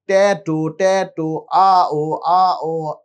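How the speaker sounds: put-on playful babbling to coax a toddler; baby babble demo. Shown as a demonstration. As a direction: model simple sounds for a baby to copy. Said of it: "এই জাতীয় কিছু শব্দ আমি করব".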